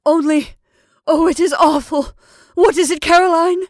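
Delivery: high, loud, anguished cry; horror-stricken. Acted. Mrs Brigham begging Caroline to explain, horror-stricken. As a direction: plead for an answer in horror. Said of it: "whose horror-stricken tone matched her sister's".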